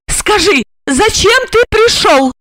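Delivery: high, fierce female interrogation; raging high-pitched question; furious demand. A narrator's reading. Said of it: "яростно требовала она".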